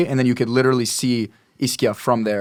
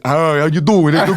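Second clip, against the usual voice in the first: imitating her dad's Italian accent, higher and a bit louder; 4 semitones higher, 5 dB louder than usual. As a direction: do your dad's Italian greeting with a laugh. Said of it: "my dad is literally like".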